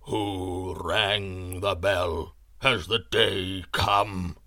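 deep, slow, booming voice of a warrior host; slow, deep and resonant, like a giant speaking; great voice from the host. A narrator's reading. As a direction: call out like a voice rising from an army underground. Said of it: "a great voice came from their midst".

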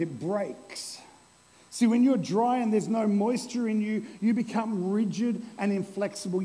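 ordinary delivery

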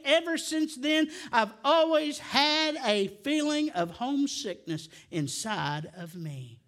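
normal talking voice

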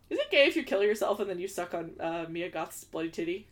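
ordinary delivery

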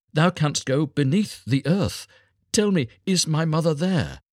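put-on star child, imploring. A narrator's reading. Star child questioning the mole.

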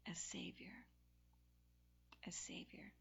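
whispered "a Savior", no voice at all. Acted. Preacher whispering "a Savior" to the room. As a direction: let "a Savior" fall to a bare whisper.

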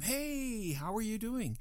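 high friendly call to the crowd. Retold or imitated. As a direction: hail them from far back, pitch way up. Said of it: "we're yelling at them".

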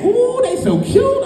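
put-on high, slow cooing over a baby; cooing parent. Parent gushing over a smelly baby, high, slow coo. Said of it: "and you saying".

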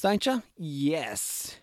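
normal talking voice

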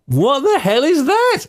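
genuinely puzzled by the job title; baffled surprise. Real, not acted. New French polisher wondering what the job even is, baffled.

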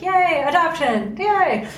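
high, bright mock cheer; cheery yay. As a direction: squeal it like a happy foster. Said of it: "they're just like".

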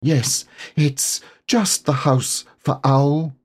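high, slow, noble Piglet voice; grandly. Acted. Piglet nobly giving away his house, grand and slow. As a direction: give it away with great dignity. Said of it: "he said grandly".

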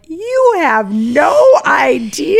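loud, high, drawn-out exclamation; acted-out exasperated outburst; retold indignant retort. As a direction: stretch each word out, high and loud, incredulous.

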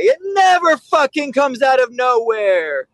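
high, slow, hammered-out rebuke; emphatic retort. Retold or imitated. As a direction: slam the point home on each word. Trainer scolds the owner.

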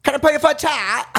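high, hammed-up British accent; British woman. Co-host playing herself as British woman, hammed-up accent. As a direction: pitch it way up in exaggerated British. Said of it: "Me as a British woman".

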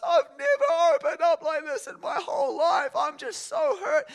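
very high, loud wail, imitating a sobbing woman; hammed-up high tearful shout; imitated sobbing outburst. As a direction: cry it out at the top of the voice, as retold pain. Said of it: "And she's sobbing".

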